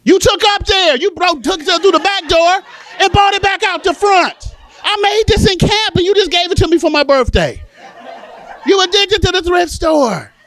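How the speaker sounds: high, loud, exasperated accusation; accusing shout. Acted. Child to mother about returned thrift store gifts, accusing shout. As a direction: shout the evidence at full, high pitch.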